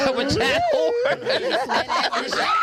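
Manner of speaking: high, slow, drawn out through laughter; laughing. Real, not acted. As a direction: squeal it while cracking up.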